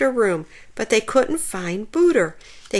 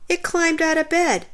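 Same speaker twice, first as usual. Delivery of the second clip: plain reading voice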